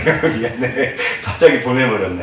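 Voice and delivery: sheepish, amused aside; real laughter under an apology; laughing apology. Real, not acted. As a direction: apologize through a chuckle. Laughing apology after teasing example.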